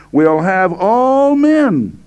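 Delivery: "all" held very long, high and strong; stress on all. Shown as a demonstration. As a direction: stretch "all" out emphatically. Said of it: "I'm emphasizing the word all here".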